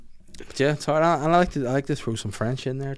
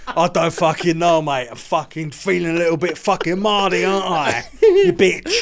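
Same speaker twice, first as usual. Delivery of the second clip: loud, higher-pitched mock Cockney; Cockney geezer; 5 semitones higher, 5 dB louder than usual. Client answering the therapist's question, over-the-top Cockney accent. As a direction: do a full-on Cockney geezer, half laughing. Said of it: "I went full Cockney geezer".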